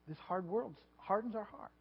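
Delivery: usual speaking voice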